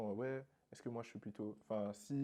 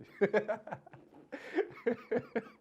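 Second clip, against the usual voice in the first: laughing; 13 semitones higher, 5 dB louder than usual. Real, not acted. Rit pendant qu'on boit du thé.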